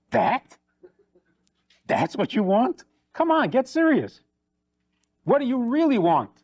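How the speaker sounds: acted disbelief, pitch jumping up; incredulous husband. Husband hears what his wife wants, incredulous and dismissive. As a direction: scoff in high-pitched disbelief.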